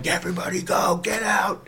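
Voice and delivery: strained, softened shout; mock yelling. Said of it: "this guy yelling at them".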